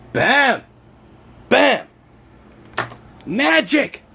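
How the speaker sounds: high, punchy triumphant exclamations; excited. Real, not acted. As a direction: celebrate the fix with showman flair. Triumphant showing the fix.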